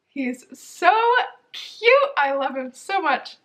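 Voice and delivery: squealed high with delight; gushing. Real, not acted. Vlogger gushing to viewers about the puppy. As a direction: melt over a cute puppy.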